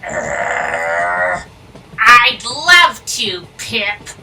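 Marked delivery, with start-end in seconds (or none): 0.0-1.4 exasperated groan. Acted. Groaning before a chore.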